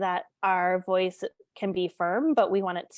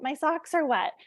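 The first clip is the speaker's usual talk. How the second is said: ordinary delivery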